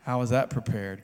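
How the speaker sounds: ordinary talk, nothing marked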